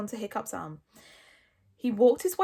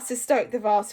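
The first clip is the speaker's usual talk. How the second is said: usual speaking voice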